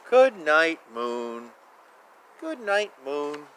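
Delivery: usual speaking voice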